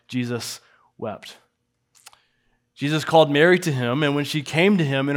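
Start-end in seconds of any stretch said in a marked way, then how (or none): 0.1-1.4 hushed and solemn. Real, not acted. Shortest verse read with weight.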